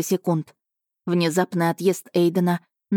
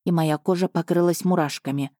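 ordinary delivery, nothing marked

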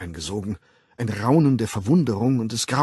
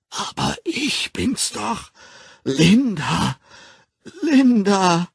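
desperate plea; 10 semitones higher, 7 dB louder, slower than usual. Acted. Linda pleading amid laughter.

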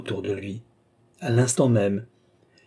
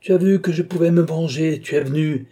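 loud, triumphant exclamation; gleeful cry; 6 semitones higher, 6 dB louder than usual. A narrator's reading. Old man sees Balsamo has come, joyful shouting. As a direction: crow over him, drunk with joy. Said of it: "cria le vieillard ivre de joie".

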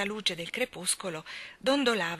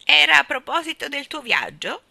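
high, loud questioning bird voice; excited bird character, urgent and loud; eager starling; 9 semitones higher, 10 dB louder than usual. Acted. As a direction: press with eager questions, pitched well up.

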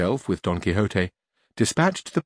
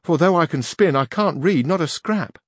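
Teresa; 7 semitones higher, 3 dB louder than usual. A narrator's reading. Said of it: "said Teresa".

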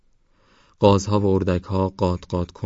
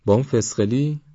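incredulous question; 6 semitones higher than usual. Acted. Surprised question about the little girl.

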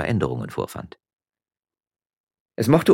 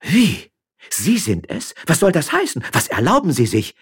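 high, loud outraged questions; more outraged than frightened, voice pitched up; indignant shouting; 11 semitones higher, 6 dB louder than usual. A narrator's reading. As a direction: fire off shocked, scandalised questions. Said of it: "schrie die Müllerin mehr entrüstet als erschrocken".

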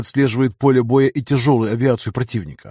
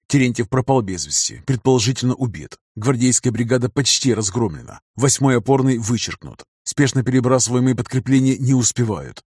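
usual speaking voice

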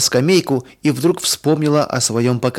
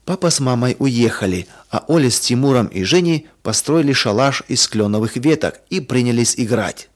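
ordinary delivery

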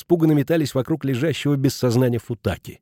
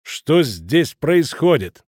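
slow, raised, commanding question; booming stern voice; 4 semitones higher, 4 dB louder, much slower than usual. A narrator's reading. Irimiás in the doorway silencing the brawl, booming and stern. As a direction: boom it out firmly, spacing the words. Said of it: "раздался зычный, решительный, строгий голос".

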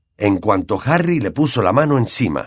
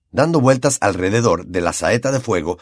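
ordinary delivery

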